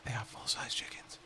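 hushed whisper, very soft; low secretive whisper; whispered aside. Real, not acted. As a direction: whisper it under the breath. Aside while luring game animals.

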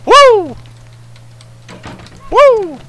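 high, loud cheer; excited whoop. Real, not acted. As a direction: whoop with joy. Cheering in game.

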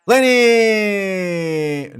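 loud, long-drawn name call; stretched-out call of a friend's name. Real, not acted. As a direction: hail him from afar. Calling out to Lenny.

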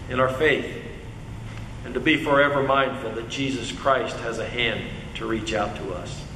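low, subdued praying voice; prayer. Real, not acted. Preacher closing a prayer for strength in faith, low and reverent. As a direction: pray quietly, voice sinking toward the amen.

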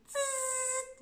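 high buzzing zzz of air leaking; balloon hiss. Shown as a demonstration. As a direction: imitate air squeezing out of a balloon. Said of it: "And then you do".

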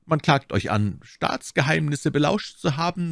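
high-pitched, measured cardinal voice; accusing cardinal. Acted. Cardinal laying charges before the arrested D'Artagnan, accusing.

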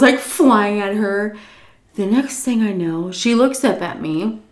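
ordinary talk, nothing marked

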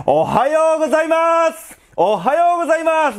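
very high-pitched loud holler; loud greeting. Shown as a demonstration. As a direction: belt out a greeting to test the mic's limit. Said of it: "だんだん大きくして".

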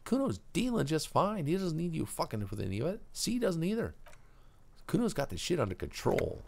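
put-on high, cocky street kid; a tough little punk guarding his turf; Kuno voice.

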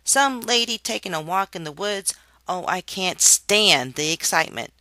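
loud, mocking boredom; bored worm jeering at his father's story; sarcastic little worm. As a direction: scoff at the tale loudly.

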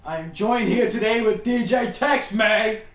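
loud, slow, high hype-man intro; hype announcer. Acted. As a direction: announce it like a stage host. Hyping intro of co-host.

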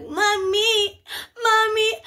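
high, loud mock cry; wailing for mother. Injured student calling for mommy, high mock wail. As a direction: call out in hammed-up tearful pleading.

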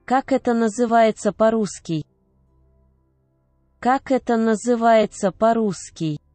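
normal talking voice

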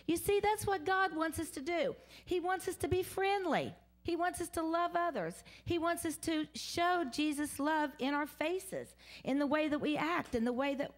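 ordinary talk

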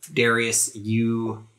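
ordinary talk

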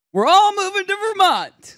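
excited announcement. Real, not acted. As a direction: announce it like huge news. Dropping the bombshell.

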